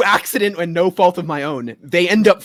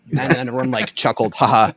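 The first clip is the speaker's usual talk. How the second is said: normal talking voice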